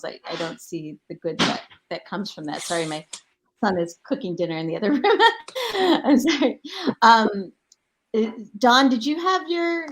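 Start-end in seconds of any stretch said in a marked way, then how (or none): 5.1-5.7 quick high laugh, a bit louder; sheepish real laugh while apologizing; embarrassed laugh. Real, not acted. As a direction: laugh it off, caught out by the noise. Son cooking dinner, embarrassed.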